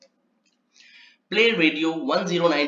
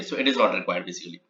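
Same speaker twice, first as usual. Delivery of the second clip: ordinary talk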